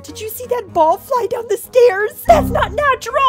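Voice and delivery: freaked out. Acted. Ball flew down the stairs.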